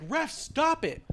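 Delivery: high-pitched mock urgent call; imitated high, urgent plea; retold plea to referee. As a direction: act out calling to the ref. Acting Jimmy appealing to the referee.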